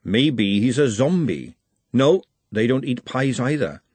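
ordinary delivery, nothing marked

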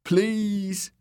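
high, pleading whimper. A narrator's reading. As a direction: beg in pain. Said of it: "Dick whimpered".

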